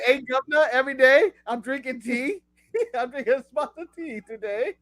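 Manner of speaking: exaggerated British impersonation of a co-host; mock British accent. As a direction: tease with a bad British accent, giggling through it. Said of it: "you try and impersonate me".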